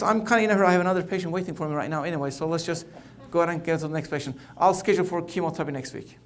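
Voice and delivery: fast, hurried brush-off; rushed doctor. Acted. Hurried doctor rushing his patient out, talking fast. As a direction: gabble through it to get out the door.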